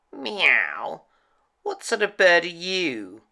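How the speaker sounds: a lightly voiced meowing cat; old cat prowling, curious and meowing. A narrator's reading.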